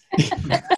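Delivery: high burst of laughter. Real, not acted. Laugh after joke.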